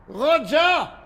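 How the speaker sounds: loud call down the hall. Acted. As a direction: shout a name across the house. Calling servant again.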